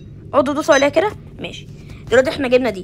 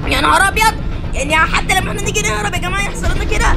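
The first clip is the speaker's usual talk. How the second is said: alarmed; 8 semitones higher than usual. Real, not acted. Train crashing in game.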